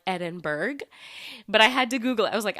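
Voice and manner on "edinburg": Edinburgh with a hard "burg" ending; said as spelled. Shown as a demonstration. Host showing the spelling of Edinburgh, said "Edin-burg". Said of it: "it's spelled like Edinburgh".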